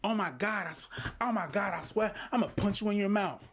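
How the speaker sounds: high-pitched mock threatening girlfriend; imitated girl's threat. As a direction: play an exasperated girl making empty threats. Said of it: "girls do the same shit".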